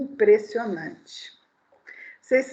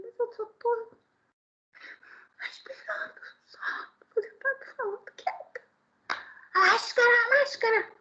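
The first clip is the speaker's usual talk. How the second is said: high, softer protesting voice; her own timid reply; 11 semitones higher, 5 dB quieter than usual. Retold or imitated. Preacher retelling her meek protest to the man on the metro, high and soft. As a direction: plead small and helpless. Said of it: "Eu falei assim".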